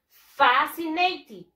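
emphatic model, "FA-si-nay-tid"; stress on first syllable. Shown as a demonstration. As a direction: stretch "fascinated" and hit FA. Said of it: "La mayor fuerza de voz".

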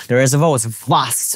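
ordinary talk, nothing marked

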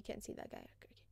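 hushed whispered aside; quiet whispered aside. Real, not acted. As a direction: whisper it as if the enemy might hear. Realizes teammate can't see enemy.

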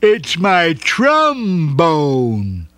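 slow proud reveal; very slow, drawn-out. A narrator's reading. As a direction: stretch each word proudly. Punchline of dog's gift.

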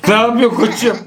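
laughing. Real, not acted. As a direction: laugh at your friend's blunder. Laughs at partner's move. (kahkahayla gülerek)

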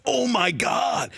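starstruck exclamation. Retold or imitated. Evans starstruck, retold by comedian.

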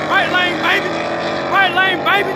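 high, loud race cheer; excited shout. Real, not acted. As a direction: yell it out as the car pulls ahead.

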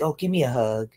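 high-pitched imitation of a doting woman; mock cooing. Imitating women reacting to a huge screaming man, mock cooing. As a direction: lift it high, asking for a cuddle. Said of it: "you're like".

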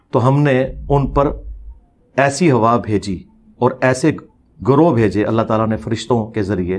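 slow, low, measured reading; scripture translation. Real, not acted. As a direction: render the verse in low phrases with long pauses. Renders the verse's translation solemnly. (आयत का धीमा, ठहरा हुआ तर्जमा)

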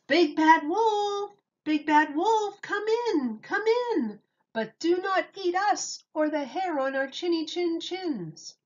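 high sing-song piglet call; yelling pigs. A narrator's reading. Pigs calling to the wolf, high sing-song voice. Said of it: "yelled out to him".

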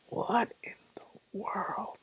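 slow, hushed disbelief; stunned whisper. Retold or imitated. Intern left alone after the door slams, stunned whisper. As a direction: whisper it slowly, drawing out the shock.

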